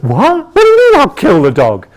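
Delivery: high, loud, fast outraged disbelief; shocked indignation. Retold or imitated. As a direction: bristle at the accusation. Sales rep shocked by accusation.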